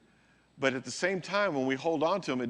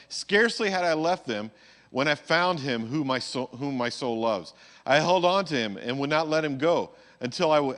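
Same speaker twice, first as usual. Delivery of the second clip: scripture reading; 5 dB louder than usual. Acted.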